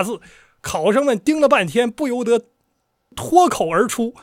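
rhythmic recital with swooping emphasis; storyteller narration. Acted. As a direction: build up to the reveal like a storyteller.

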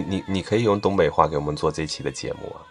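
ordinary delivery

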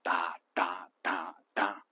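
哒哒哒哒 as four slow, even, hushed beats; 哒哒哒哒 like a slow whispered metronome; rhythmic beat. Shown as a demonstration. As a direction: tap out 哒哒哒哒 softly, one beat per syllable. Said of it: "是作为一个节奏".

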